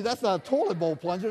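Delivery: ordinary delivery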